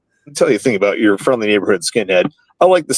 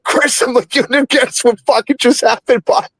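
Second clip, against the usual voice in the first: high, rushed adrenaline babble; like a squirrel in a meth lab; wired, excited; 11 semitones higher than usual. Retold or imitated. Bursting in on Chris after the fight, high and wired. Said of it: "talking like a squirrel in a meth lab".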